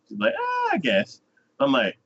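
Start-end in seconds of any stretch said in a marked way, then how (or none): 0.4-1.2 very high, grudging sigh of a yes; high mock I guess; squeaky reluctant agreement. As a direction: squeak it out, half giving in. Girl character agrees, retold.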